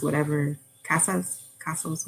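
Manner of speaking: normal talking voice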